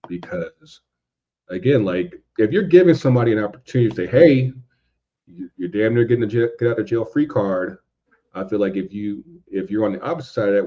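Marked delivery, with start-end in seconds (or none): none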